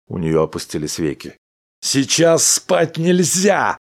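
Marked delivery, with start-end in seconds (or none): 1.8-3.8 slow, higher, louder yell; husband shouting at his dozing wife. A narrator's reading. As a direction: bellow it to keep her awake. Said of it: "закричал Дональдсон".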